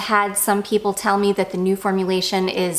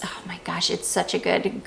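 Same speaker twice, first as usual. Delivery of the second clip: whisper; 6 dB quieter than usual. Real, not acted. Gushing over a perfume.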